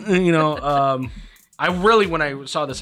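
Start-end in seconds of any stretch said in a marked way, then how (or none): none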